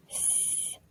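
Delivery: "s" as a long unvoiced hiss; isolated s sound. Shown as a demonstration. Teacher names the sound to swap, "s" hissed. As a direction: hold "s" alone as sss, not the letter's name. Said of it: "what if I was to change my".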